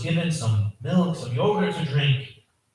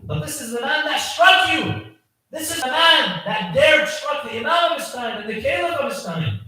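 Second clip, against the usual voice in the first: raised, higher retold outcry; voicing a son's outraged objection; indignant protest; 9 semitones higher than usual. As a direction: protest with rising indignation, as the son. Son protests mercy for father's attacker.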